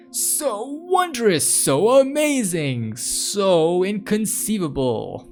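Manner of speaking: slow, high, grandly drawn-out wonder; theatrical gushing, voice soaring; hammed-up awe. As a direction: marvel extravagantly, stretching each phrase. Mock awe at subway card.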